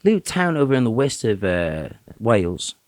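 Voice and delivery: a hint of Welsh-British storyteller, making it up as he goes; British accent. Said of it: "did a British accent the whole time".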